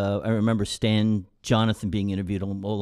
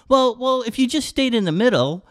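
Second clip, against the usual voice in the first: high, pushy reporter voice, louder; mocking reporter voice; 12 semitones higher, 5 dB louder than usual. Scott Oak grilling Redden on camera, in a high pushy voice. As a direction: ham up the smug interviewer pressing the player.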